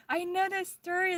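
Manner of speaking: high-pitched retold reaction; imitated excitement. Retells receptionist revealing they know.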